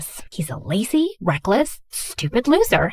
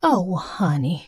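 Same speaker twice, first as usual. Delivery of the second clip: soft, slow and tender; gently moved, slowing down; 4 semitones lower, 4 dB quieter, slower than usual. Acted. Touched by his sad confession.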